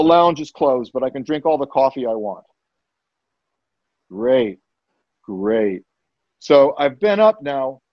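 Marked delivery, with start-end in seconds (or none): 4.1-5.8 low, resigned sarcasm; deadpan dismay. Retold or imitated. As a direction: drop it low, unimpressed. Stuck awake in closet room.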